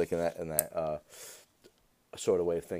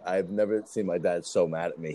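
ordinary talk, nothing marked

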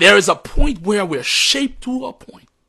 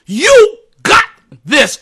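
plain; 6 semitones higher, 5 dB louder, slower than usual. Shown as a demonstration. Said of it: "repeated encouragement, raised second take".

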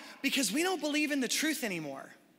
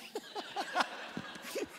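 breathy laugh; 9 semitones higher, 8 dB quieter than usual. Real, not acted. Laughs at son's answer.

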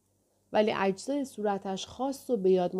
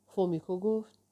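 usual speaking voice

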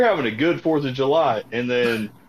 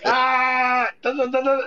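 gunfire noises; 11 semitones higher, slower than usual. Retold or imitated. Mock firing rifle into air.

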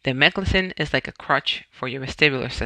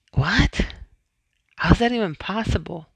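higher-pitched incredulous question; retold disbelief; 5 semitones higher than usual. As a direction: question the diagnosis in mild astonishment.

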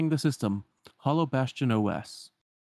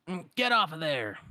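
squawky, higher duck scold; Donald scolding; 6 semitones higher than usual. Retold or imitated. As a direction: snap at him to get down. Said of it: "Donald calls".

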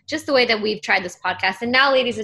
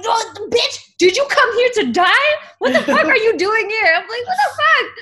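shocked outburst; 11 semitones higher, 4 dB louder than usual. Retold or imitated. Startled by dying cockroach in sink.

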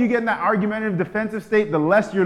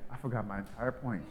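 softer, lower, a bit lost; quietly deflated; deflated realization; 7 semitones lower, 13 dB quieter than usual. Retold or imitated. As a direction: mumble it, baffled. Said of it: "and you're like".

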